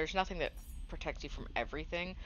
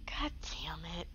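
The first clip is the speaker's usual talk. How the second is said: whispered curse; 4 dB quieter than usual. Real, not acted. After dying in game.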